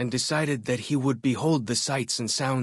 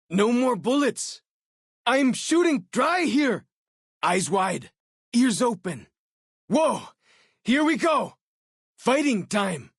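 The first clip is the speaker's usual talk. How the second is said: high, loud combat yells; battle cries; 11 semitones higher, 4 dB louder than usual. Acted. Companion shouting in combat, loud battle cries. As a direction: yell it in the thick of the fight.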